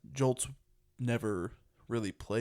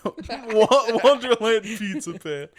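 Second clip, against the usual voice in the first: high, loud laughing repeat; real laughter; 11 semitones higher, 12 dB louder, slower than usual. Real, not acted. Repeating co-host's silly phrase, cracking up laughing. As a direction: crack up while repeating it, pitch shooting up.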